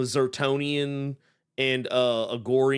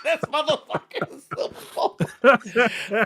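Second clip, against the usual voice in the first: helpless high-pitched laughter through the words; laughing hard; 10 semitones higher, much slower than usual. Real, not acted. Co-host reacting to a Silverbolt jab, laughing hard. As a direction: crack up while talking.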